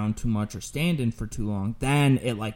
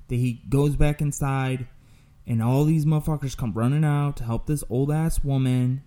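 ordinary delivery

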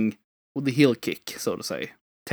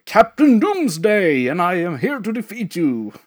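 high, loud, slow pompous villain announcement; Captain Doomsday; 6 semitones higher, 5 dB louder than usual. Retold or imitated. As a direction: boom it out like a cartoon supervillain. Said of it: "And you, you have, like".

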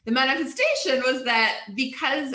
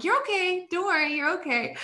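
high, sing-song soothing to an angry driver; mock reassurance; 8 semitones higher than usual. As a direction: coo it at him over a smile, laughing. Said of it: "smiling and waving".

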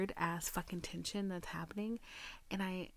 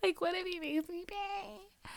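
higher, squeezed, half-laughing panic; high mock panic; 9 semitones higher than usual. Repeating her fear to viewers, high mock panic. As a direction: squeak it out in comic alarm. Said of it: "I was kinda scared".

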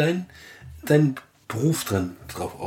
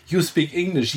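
Englishman speaking broken German; English accent; 7 semitones higher than usual. Retold or imitated. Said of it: "Wie der Engländer kommt und sagt". (englischer Akzent)

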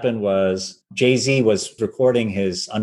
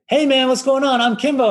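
over-eager greeting. Pretend. Violinist pranking the producer, over-eager put-on greeting. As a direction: bounce in as the clueless new guy. Playing fresh-off-the-boat Kimbo prank.

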